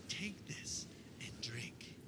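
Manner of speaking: a soft, slow, breathy-free hush. Acted. Jesus offering the cup, hushed and slow. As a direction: hand it over in a near whisper. Jesus voiced at the Last Supper.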